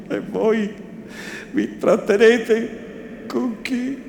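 high, strained voice in an altered tone, pleading and breaking; a broken man, begging to be left alone; anguished sinner. A narrator's reading. The repentant man begging the bishop to leave him, high and anguished.

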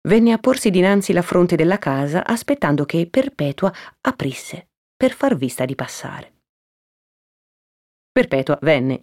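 ordinary delivery, nothing marked

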